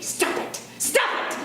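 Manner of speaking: whispered, harsh imitation of the dad; harsh whispered scolding. Comic imitating the scolding dad, harsh whisper. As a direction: hiss the order at him. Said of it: "I was like".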